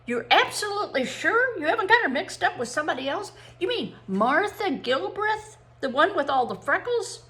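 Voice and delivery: high-pitched rising disbelief; incredulous. A narrator's reading. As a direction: question it as if it can't be true. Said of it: "in an incredulous voice".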